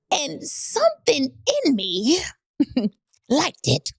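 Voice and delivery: high, slow, giggling admission; laughing confession. Real, not acted. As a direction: giggle through the guilty reveal.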